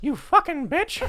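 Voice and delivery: very high, loud, slow cartoon mouse cursing; Mickey Mouse. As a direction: squeak out the insult like Mickey. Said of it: "in that voice".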